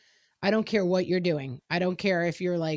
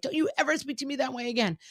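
mock angry scold; 6 semitones higher, faster than usual. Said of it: "I lose my temper and I'm like".

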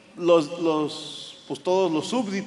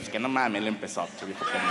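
quiet grumble; 8 semitones lower, 7 dB quieter, faster than usual. Retold or imitated.

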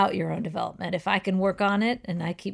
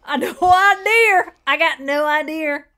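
punchline voice; 7 semitones higher, 8 dB louder than usual. Shown as a demonstration. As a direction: stretch the pun on no idea. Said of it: "What do you call a deer with no eyes?".

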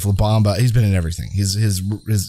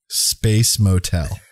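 no inflection; much slower than usual. Shown as a demonstration. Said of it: "read these with no inflection".

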